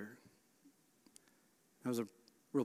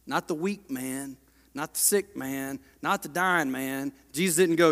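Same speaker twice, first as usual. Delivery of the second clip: ordinary talk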